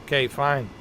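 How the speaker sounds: ordinary talk, nothing marked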